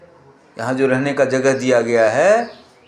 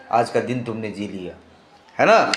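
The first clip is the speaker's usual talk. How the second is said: usual speaking voice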